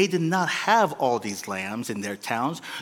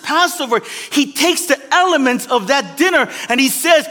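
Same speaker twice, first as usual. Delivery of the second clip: high, loud, driving pulpit preaching; fiery preacher pushing the congregation to understand; preaching at full force; 10 semitones higher, 8 dB louder than usual. Acted. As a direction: build it like a revival sermon, voice soaring. Pastor preaching Passover story.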